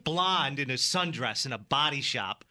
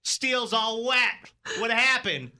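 loud, higher-pitched mock mechanic; mechanic Rico; 8 semitones higher, 5 dB louder, slower than usual. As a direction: break bad news slowly, hammed-up. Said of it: "standing next to a mechanic named Rico".

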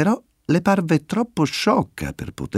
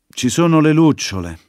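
reluctant admission; 5 semitones higher, 3 dB louder than usual. Acted. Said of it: "dovette ammettere".

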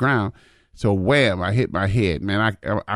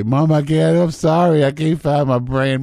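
high, loud mock-tearful child wail; hammed-up tearful apology; mock crying; 8 semitones higher, 5 dB louder than usual. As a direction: plead to mom like a crying kid. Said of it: "Man, I start crying".

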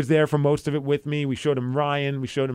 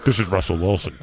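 lightly put-on, lower celebrity impression; jokey impression, lower than usual; Russell Wilson; 5 semitones lower than usual. Shown as a demonstration. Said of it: "Sounds kinda like Russell Wilson".

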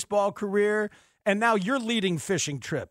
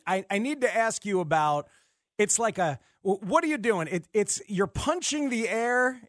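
ordinary delivery

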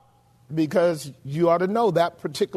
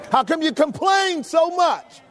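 preached shout; 8 semitones higher, slower than usual. Acted. Question repeated, then shouted.

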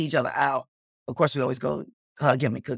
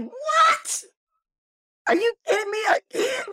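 a much higher, shouted disbelieving "What? Are you kidding me?"; shocked shout. Retold or imitated. Hearing she's invited again, a high disbelieving shout. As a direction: yell it out in giddy disbelief. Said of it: "And I'm like".

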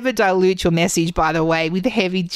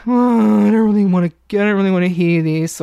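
bored, reluctant mock audience member, louder; 4 dB louder than usual. Audience member who doesn't want to hear the talk, reluctant. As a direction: grumble like someone dragged along unwillingly. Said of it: "They may be".

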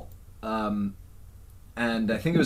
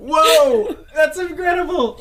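excited; 14 semitones higher, 6 dB louder than usual. Real, not acted. Delighted reaction.

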